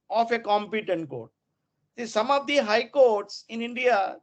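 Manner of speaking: ordinary talk, nothing marked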